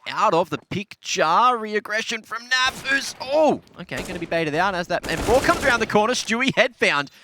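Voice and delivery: high, loud excited esports call; live play-by-play. Real, not acted. Caster calling Naf's one v two clutch, excited play-by-play.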